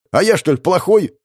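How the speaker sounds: wounded, indignant question; hurt. A narrator's reading. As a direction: sound stung and offended. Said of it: "Уязвлённо выдохнул он". (уязвлённо)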